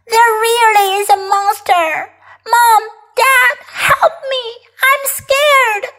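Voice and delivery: put-on high, pleading child's cry; frightened yelling. Kipper calling Mom and Dad, frightened yelling. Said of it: "yelled Kipper".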